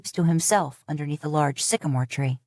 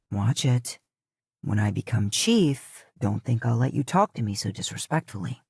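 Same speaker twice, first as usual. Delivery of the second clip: low cocky cousin; 7 semitones lower than usual. A narrator's reading. Shota warning his cousin, low smug voice. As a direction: lean in with a lordly threat.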